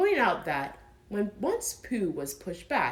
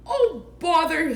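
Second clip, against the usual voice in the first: high, louder exasperated sigh of a word; bothered Pooh; 10 semitones higher, 8 dB louder than usual. Acted. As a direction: grumble it like a put-upon bear. Pooh stuck, annoyed.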